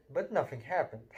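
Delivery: plain reading voice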